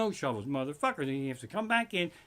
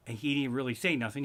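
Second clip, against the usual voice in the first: ordinary talk, nothing marked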